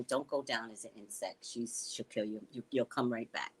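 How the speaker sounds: a hushed warning from the dead relatives; ancestors warning. Retold or imitated. Ancestors in heaven, voiced.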